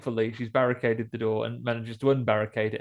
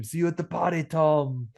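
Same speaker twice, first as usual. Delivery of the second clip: higher, action-hero one-liner; movie one-liner; 5 semitones higher than usual. Retold or imitated. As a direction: deliver it like a cool film quip. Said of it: "She might say to him".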